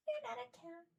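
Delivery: high, playful scolding coo; high-pitched mock scold to a pet. Real, not acted. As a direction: tease the cat way up high. Teasing cat in baby voice.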